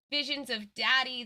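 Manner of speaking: ordinary delivery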